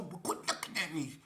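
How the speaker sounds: unvoiced whisper telling him to look away; whispered order. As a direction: whisper it so nobody inside hears. Whispering at the door.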